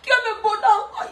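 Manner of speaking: high, whining mock complaint with laughter; whiny Bangala voice. Host voicing the Bangala complaining to mama, high mock whine. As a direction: play the Bangala pleading to mama, half-crying. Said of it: "le Bangala dit".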